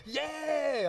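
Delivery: high drawn-out mock cheer; long high whoop of approval; crowd cheer. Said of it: "this whole Singapore audience in Tony Robbins goes".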